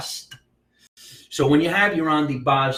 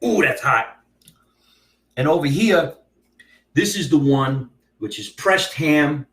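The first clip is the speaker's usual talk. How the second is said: normal talking voice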